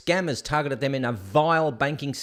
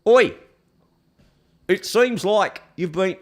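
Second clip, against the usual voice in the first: high, loud mock British accent; badly faked British caller; 4 semitones higher, 6 dB louder than usual. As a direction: attempt a hammed-up British accent. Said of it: "He had a British accent".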